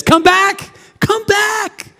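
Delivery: high, soaring plea; preacher's high appeal; pleading call. Real, not acted. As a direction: call them home from far away.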